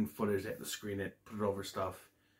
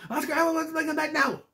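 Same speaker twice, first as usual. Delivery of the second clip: high squeaky Elmo, faster and more frantic; Elmo freaking out; faster than usual. Retold or imitated. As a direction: rush it out in a panicked Elmo voice. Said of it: "He's freaking out".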